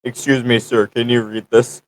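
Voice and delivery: usual speaking voice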